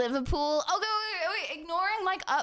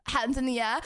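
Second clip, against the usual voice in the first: ordinary talk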